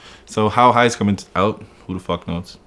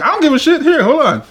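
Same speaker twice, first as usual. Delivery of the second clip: high-pitched mock exasperation; exasperated exclaim; 4 dB louder than usual. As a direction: toss it off in a squeaky retold outburst. Retold brushing off the cashier.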